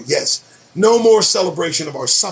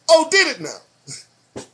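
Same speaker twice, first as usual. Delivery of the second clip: sarcastic; 9 semitones higher, slower than usual. Real, not acted. Sarcastic reply to headline.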